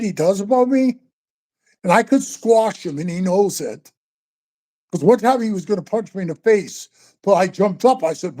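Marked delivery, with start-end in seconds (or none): none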